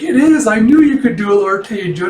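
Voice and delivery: high, loud praise like a proud parent; delighted, high-pitched cheer. Retold or imitated. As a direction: cheer them on like a parent whose kid succeeded. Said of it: "like a parent, like, cheering on his kid".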